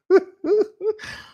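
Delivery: high, real laughter; laughing. Real, not acted. Clinician cracking up over a made-up disorder, high laugh. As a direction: crack up mid-sentence.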